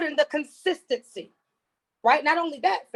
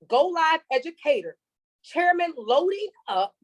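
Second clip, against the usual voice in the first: ordinary talk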